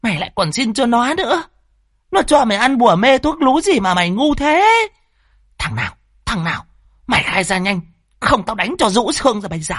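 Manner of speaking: high-pitched, exasperated threats; incensed mother at the end of her patience; angry scolding. Acted. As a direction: berate the daughter and demand a name. Mother rages at pleading daughter.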